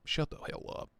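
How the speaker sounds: soft unvoiced whisper under the breath; whispered aside. Real, not acted. Reacting to a knife listing, a whispered aside. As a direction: hush it out in mock disbelief.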